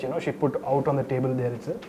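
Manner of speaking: usual speaking voice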